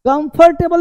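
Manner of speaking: कंफर्टेबल high-pitched with full "fart-TAY-bal"; wrong pronunciation. Shown as a demonstration. Teacher rejects the common form, overpronounced. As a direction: say कंफर्टेबल with heavy middle syllables. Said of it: "नहीं".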